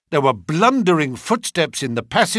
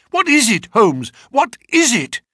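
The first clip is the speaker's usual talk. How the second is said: high, loud frantic question; alarmed shout; 9 semitones higher, 5 dB louder than usual. Acted. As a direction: demand an answer urgently. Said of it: "he cried".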